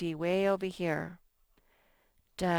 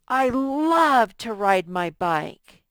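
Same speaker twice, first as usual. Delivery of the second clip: "I love to ride my bike" slow, loud, with "love" punched; teacher showing strong feeling, loud and slow; excited exclamation; 3 semitones higher, 10 dB louder, much slower than usual. Shown as a demonstration. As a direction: sell it like you're thrilled about riding. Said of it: "how excited we are".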